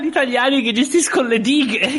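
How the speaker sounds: high, sarcastic mock quote. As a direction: scoff at Italians managing dams. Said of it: "a dire". (tono canzonatorio)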